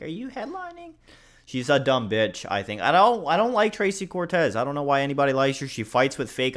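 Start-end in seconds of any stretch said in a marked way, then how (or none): 0.0-0.9 very high, quiet mocking squeak; mocking quote. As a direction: mimic her as a clueless airhead. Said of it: "I was like".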